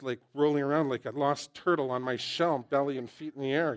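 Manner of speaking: normal talking voice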